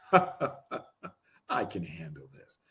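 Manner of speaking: very low, hushed, slow self-assurance; inner voice. Acted. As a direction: murmur it slowly like a private thought. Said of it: "hear a voice inside your head".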